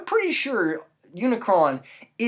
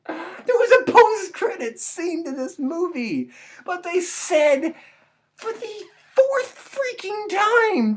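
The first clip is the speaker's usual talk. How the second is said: much higher, shrill-pitched incredulous ranting; exasperated rant; 11 semitones higher than usual. Real, not acted. Reviewer venting about yet another sequel, high and incredulous. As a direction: build up in disbelief, voice climbing.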